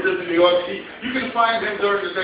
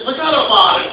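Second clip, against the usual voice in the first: emphatic New York "Forget about it", pitched up; New York accent; 4 semitones higher, 4 dB louder than usual. Acted. Customer teaches clerk the phrase, emphatic New York accent. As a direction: show him the punchy New York way. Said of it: "you really gotta be from New York".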